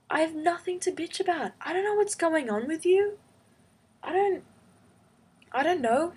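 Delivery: high-pitched, incredulous retelling of her own rant; exasperated rant. Retold or imitated. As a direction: pile on the disbelief, voice climbing high. Retold rant at friend's girlfriend.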